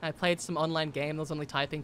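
usual speaking voice

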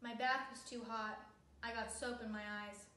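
ordinary delivery, nothing marked

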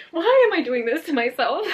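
high, loud, exasperated wail; loud self-pitying cry with a laugh behind it; anguished lament. Real, not acted. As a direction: wail it at yourself. Lamenting her emotional investment.

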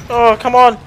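high loud frantic yells; panicked shouting. Real, not acted. Panicking during game fight.

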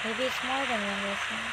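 very soft, barely audible murmur; barely a whisper. Acted. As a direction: say it almost to yourself. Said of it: "It was barely a whisper".

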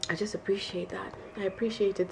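hushed, breathy appreciation; whisper. Real, not acted. Telling viewers she admires Jamie, in a whisper. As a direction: whisper it like a confession. Heartfelt aside to camera.

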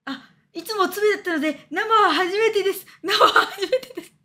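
laughing mock coy voice; mock shy confession. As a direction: play a bashful fan confessing through giggles. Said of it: "そっか、生初めてだったんや。".

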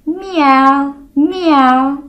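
playful meowing; cat meow imitation. Shown as a demonstration. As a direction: meow like a kitten. Demonstrates cat sound.

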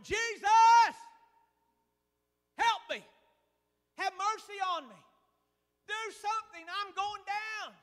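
high, loud panicked cry for help; desperate hollering. Retold or imitated. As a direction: holler like a drowning man. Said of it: "He was hollering".

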